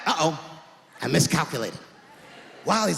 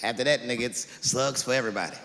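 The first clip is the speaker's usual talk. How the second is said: ordinary delivery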